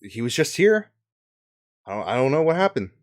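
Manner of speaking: fast, loud, baffled protest; flustered knights. Acted. Knights explain the prisoner vanished. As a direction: rush it out, bewildered. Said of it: "the rest of the knights go".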